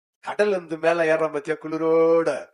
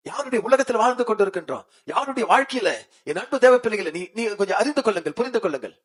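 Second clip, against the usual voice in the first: usual speaking voice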